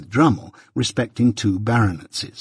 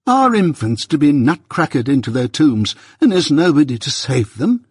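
high, emphatic despairing protest; desperate outburst; 5 semitones higher, 4 dB louder than usual. A narrator's reading. Mister Pocket protesting for the endangered infants, desperate. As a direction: appeal in exasperated desperation. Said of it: "in an outbreak of desolate desperation".

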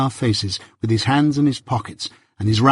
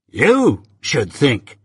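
"You should think" drawled very slowly, leaning on You; a surly lout mimicking his rival; mocking echo; much slower than usual. A narrator's reading. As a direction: sneer the words back at him, slow and scornful. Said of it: "retorted Drummle".

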